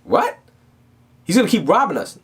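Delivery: high-pitched incredulous protest; imitated shock at being robbed; shocked face. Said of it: "I remember her face like".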